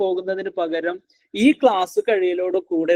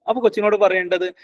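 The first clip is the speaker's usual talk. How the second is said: ordinary talk, nothing marked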